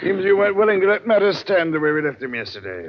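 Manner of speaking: high, loud sharp reproach; annoyed. Acted. Captain reproaches Warner, annoyed and loud. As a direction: scold him for going over your head.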